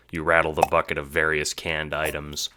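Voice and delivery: ordinary delivery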